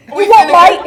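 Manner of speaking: put-on loud English accent through laughter; laughing English accent. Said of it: "English accent, [name]".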